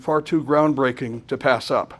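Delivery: normal talking voice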